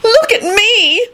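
very high, stretched-out squeal; ecstatic, long high cry; ecstatic shout. A narrator's reading. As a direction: show off in sheer delight. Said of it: "she shouted ecstatically".

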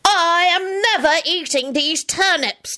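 high, slow, hammed-up protest; melodramatic shouting. A narrator's reading. Boy refusing turnips, melodramatic and high. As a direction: wail every word out defiantly. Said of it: "Teddy shouted melodramatically".

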